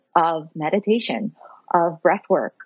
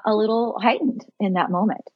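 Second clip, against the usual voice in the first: usual speaking voice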